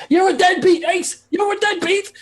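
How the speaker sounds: very high-pitched, hammed-up yell; shouting. Game master acting an overheard street row, high mock shouting. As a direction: yell it in a squealing put-on voice. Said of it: "Ace or Stiffy shouting".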